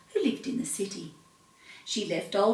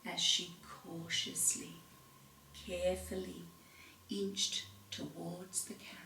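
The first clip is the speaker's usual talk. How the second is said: hushed whisper; 4 semitones lower, 16 dB quieter than usual. A narrator's reading. Narrator whispering as Belinda inches closer. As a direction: creep the words like the cautious cow. Cow creeping toward carrot.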